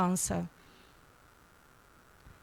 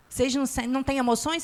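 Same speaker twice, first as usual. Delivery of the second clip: ordinary talk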